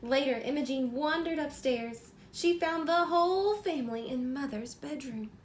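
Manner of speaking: bright, lively storybook reading; reading to children. A narrator's reading. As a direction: read it like bedtime story time for a little one. Reads picture book aloud.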